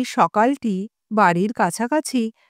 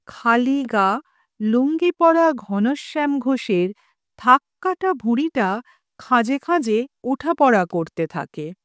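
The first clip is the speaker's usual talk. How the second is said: usual speaking voice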